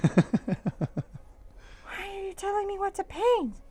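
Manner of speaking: indignant son. Retold or imitated. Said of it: "He's looking at me like".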